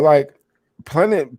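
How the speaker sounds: normal talking voice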